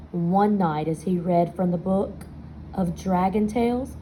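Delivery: usual speaking voice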